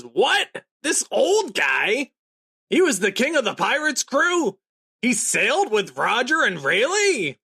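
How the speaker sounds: high, loud, stunned Luffy; shocked disbelief. Retold or imitated. Luffy learning the old man's past, high and shocked. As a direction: gasp it out like you can't believe it.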